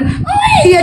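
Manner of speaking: very high mock baby crying owe; baby wail. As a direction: wail like a newborn, high and thin.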